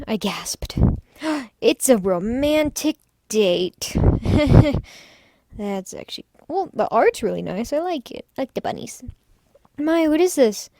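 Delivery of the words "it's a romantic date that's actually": ordinary talk, nothing marked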